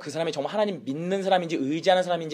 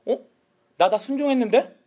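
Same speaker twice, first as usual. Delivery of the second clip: higher, puzzled protest; feigned innocent surprise; 7 semitones higher, 4 dB louder than usual. Acted. Saul answering Samuel's rebuke, innocently surprised. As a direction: play innocent, pitched up in disbelief.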